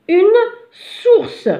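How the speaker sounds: "source" high and drawn out after a pause; high, separated dictation of "Une source"; sounding out. Shown as a demonstration. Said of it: "هنا ما سمعناش ze".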